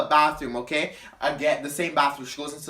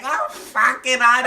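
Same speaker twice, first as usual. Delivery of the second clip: high, loud, drawn-out mockery; exaggerated drunk impression; mocking; 8 semitones higher, 6 dB louder, slower than usual. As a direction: mimic the arguing drunks, stretching the words. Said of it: "we're kinda mocking them".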